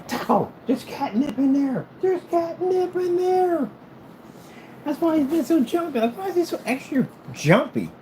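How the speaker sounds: very high, drawn-out exclaiming; pet talk. Real, not acted. Owner telling Taco about the catnip, pet talk.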